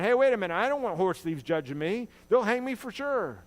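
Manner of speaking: high, loud, panicked protest; alarmed outburst. Retold or imitated. Horse thief protesting to the judge, high panicked shout. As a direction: shoot up and yell it in alarm.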